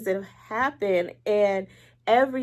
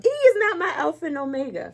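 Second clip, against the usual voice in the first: emphatic; 8 semitones higher, 7 dB louder, faster than usual. Real, not acted. Emphatic declaration about husband.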